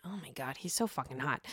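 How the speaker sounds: softly gushing crush; swooning hush. Real, not acted. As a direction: drop to a dreamy hush. Swooning over NBA star.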